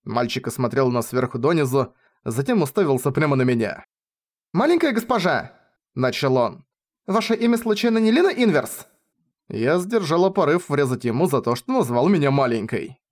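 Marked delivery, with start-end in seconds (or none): 4.5-5.6 put-on high-pitched young boy; boy's voice. A narrator's reading. 7.1-8.9 put-on high-pitched young boy; boy's voice. A narrator's reading. Narrator voices the boy asking.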